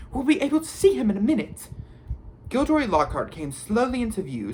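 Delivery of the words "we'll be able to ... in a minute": high, rushed flutter of excitement; breathless. A narrator's reading. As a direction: rush it out breathlessly, high-pitched. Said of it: "She sounded breathless".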